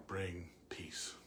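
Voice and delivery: ordinary talk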